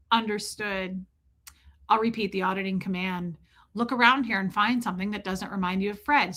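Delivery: emotionless, rote auditor script; dead-behind-the-eyes procedural recital; lifeless auditor. Acted. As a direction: carry on as if the grief means nothing. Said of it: "Dead behind the eyes".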